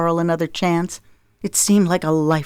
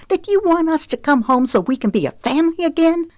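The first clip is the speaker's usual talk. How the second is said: screech; 12 semitones higher than usual. Acted. Zoe screeches at her cheating husband on the phone. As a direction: let it climb into a screech. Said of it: "her voice escalated to a screech".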